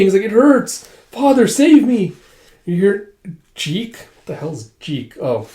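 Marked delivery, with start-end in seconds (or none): 0.2-4.3 high, loud, pained wail; hammed-up distressed heroine; acted cry for help. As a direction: wail for rescue, stretching the words. Girl attacked, calls for help.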